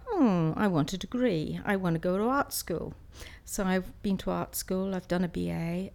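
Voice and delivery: usual speaking voice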